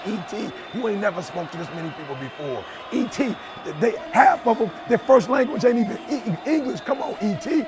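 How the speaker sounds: undermine yourself in a hushed tone; doubting inner voice. Retold or imitated. Old self whispering doubts before the Cairo speech, soft. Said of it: "all I can hear is the old E T saying".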